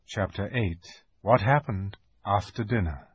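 ordinary delivery

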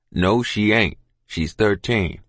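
low, firm contradiction; firm father. A narrator's reading. Father contradicts mother about child, firm.

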